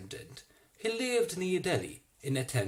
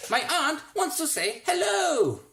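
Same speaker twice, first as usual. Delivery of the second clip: yelling; 6 dB louder than usual. A narrator's reading. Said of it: "boy yelled".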